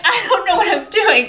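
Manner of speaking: high-pitched flustered self-quote through a laugh. Retold or imitated. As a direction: laugh helplessly while retelling your own panic.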